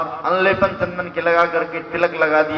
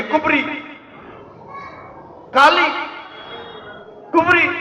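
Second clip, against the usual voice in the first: mocking name-calling; 8 semitones higher than usual. Mimicking the names people call her.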